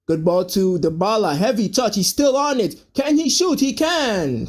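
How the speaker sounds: excited commentary, pitch climbing to the shot; live play-by-play. Real, not acted. Calls a live attack.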